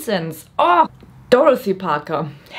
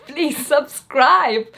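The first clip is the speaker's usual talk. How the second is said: odd put-on voice; 7 semitones higher, much slower than usual. Shown as a demonstration.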